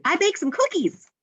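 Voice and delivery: high, excited announcement; excited exclamation. Acted. Teacher showing the exclamation-mark version, excited. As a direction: exclaim it, pitch jumping well up. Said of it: "could end it with an exclamation mark".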